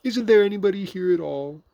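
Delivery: high, loud plea; calling out. A narrator's reading. Pooh asking whoever is in the hole, calling out.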